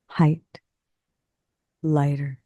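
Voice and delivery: plain reading voice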